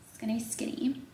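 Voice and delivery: soft, whispered prediction. Real, not acted. Doubting the room service portion, whisper. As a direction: mutter it under your breath. Muttering about small portion.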